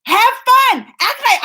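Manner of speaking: high, loud mock yell; re-enacted parental yelling; imitating her own yelling. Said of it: "I was yelling at them".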